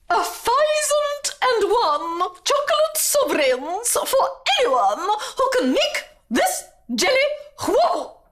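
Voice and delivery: very high, grand proclamation; royal announcement. A narrator's reading. Princess offers reward to the guests, proclaiming. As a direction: announce it to the whole court. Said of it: "announced the princess".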